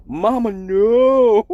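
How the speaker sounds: stretched slow and loud, mock crying; mock slow-motion wail at mom; slow-motion plea. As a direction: drag it out like a slow-motion replay. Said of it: "I was seeing everything in slow motion".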